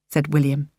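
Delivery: ordinary delivery, nothing marked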